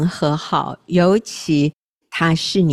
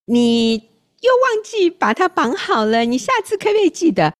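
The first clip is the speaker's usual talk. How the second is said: mock nagging, pitched way up; high-pitched scolding; 11 semitones higher than usual. Spouse nagging partner about forgetting to tie the bag, high-pitched mock scolding. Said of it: "你怎么声音变那么高".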